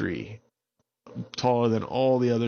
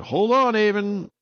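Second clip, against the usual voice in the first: high, loud urgent warning; urgent call; 12 semitones higher, 7 dB louder than usual. Acted. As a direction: shout a quick warning to hold tight. Unicorn warning during chase.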